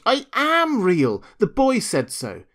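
high, loud, drawn-out little rabbit; insistent protest. A narrator's reading. Toy rabbit near tears defending himself, insistent.

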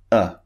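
"uh" as a very short, simple schwa; short neutral vowel. Shown as a demonstration. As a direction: say "uh" with relaxed, unrounded lips. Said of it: "the mouth is in a neutral position".

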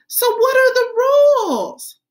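put-on high, bouncy little girl voice; excited child voice. Teacher voicing Chantel to the class, high and eager. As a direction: play an eager kindergartner.

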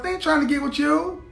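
ordinary talk

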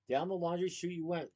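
plain reading voice

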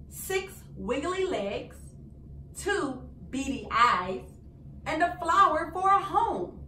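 ordinary delivery, nothing marked

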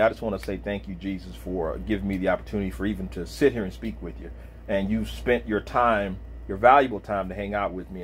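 soft, low prayer voice; closing prayer. Real, not acted. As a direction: pray quietly to close.

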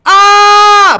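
high, loud startled scream; real scream. Real, not acted. Gamer startled by a jump scare, screaming.

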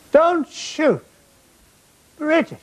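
high-pitched mock shout, no real volume; retold shout. Soldier calling to what appeared to be American helmets, retold shout. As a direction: act out calling to troops on a hill. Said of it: "So I shouted".

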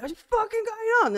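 sudden high, loud yelp of alarm; leader's calm cracking; alarmed outburst. Acted. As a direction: snap out in shock. Darla breaks at the chaos.

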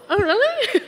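laughing, high "Oh, really?" in disbelief; mock surprise. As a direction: play the doubter, through a laugh. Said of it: "you might go".

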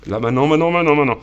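loud, high, rapid-fire cue; urgent shout. Real, not acted. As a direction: burst out with the signal to act. Signal d'agir après l'attente. (cri urgent, fort et aigu)

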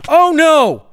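high, loud startled oh no; alarmed cry. Real, not acted. Player about to die, loud high alarmed cry. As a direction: cry out as it all goes wrong. Dying in game.

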